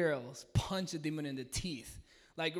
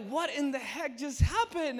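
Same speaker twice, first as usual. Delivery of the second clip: amazed disbelief; 9 semitones higher, 5 dB louder than usual. Retold or imitated. Disbelief after healing.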